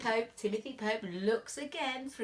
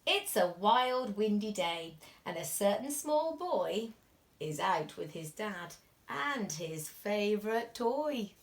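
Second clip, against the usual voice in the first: usual speaking voice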